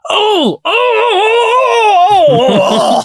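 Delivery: high crying shout, oh over and over; anguished shouting. Retold or imitated. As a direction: wail each oh in dismay. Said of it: "I literally went".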